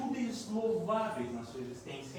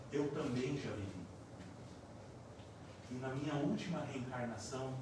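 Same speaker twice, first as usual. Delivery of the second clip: tender mother; 6 semitones lower, 6 dB quieter than usual. Retold or imitated. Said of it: "ela fala mais ou menos assim pra ele".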